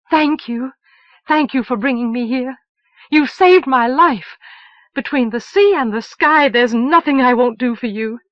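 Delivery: loud, high, pouring out thanks; fervent gratitude. Acted. Moon to Elsevier who saved her life: fervent, loud, grateful thanks.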